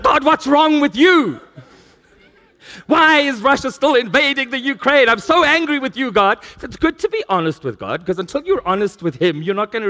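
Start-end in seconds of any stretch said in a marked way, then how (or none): 0.0-6.4 mock tantrum. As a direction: play a cross three-year-old demanding answers. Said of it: "you're a three-year-old throwing a tantrum".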